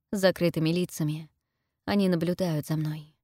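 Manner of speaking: ordinary delivery, nothing marked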